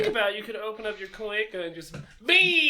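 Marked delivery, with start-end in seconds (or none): 2.2-2.7 high-pitched, loud "bee!"; high excited squeal. Pretend. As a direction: fling the word out in glee. Player mimics a bee shot.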